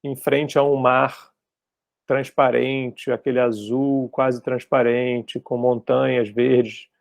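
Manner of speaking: slow, measured guiding with long pauses; guided meditation leader. Acted. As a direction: lightly imitate someone leading a visualization, unhurried. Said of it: "A pessoa pode tá falando agora".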